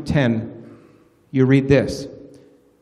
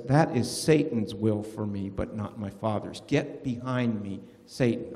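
grave rebuke; 7 semitones lower, 7 dB quieter than usual. Retold or imitated. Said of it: "And Jesus says".

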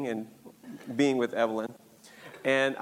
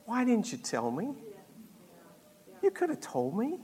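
soft, higher-pitched gentle reproach; gentle, not mad; 6 semitones higher, 6 dB quieter than usual. Retold or imitated. Forgiving dad asks son why he hid it, gentle and not mad. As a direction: ask it softly, without anger. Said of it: "and he wasn't mad".

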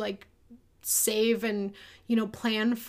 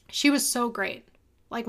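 usual speaking voice

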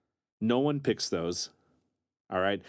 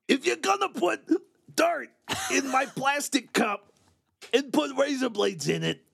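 Gilbert Gottfried voice; 12 semitones higher than usual. Pretend. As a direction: screech it like a shrill comic. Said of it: "It's like Gilbert Gottfried".

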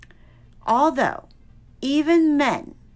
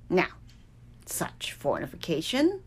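usual speaking voice

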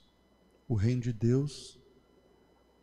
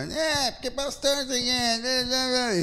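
put-on drunk heckler; 13 semitones higher, much slower than usual. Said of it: "o cara lá tá".

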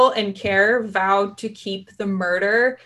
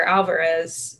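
ordinary talk, nothing marked

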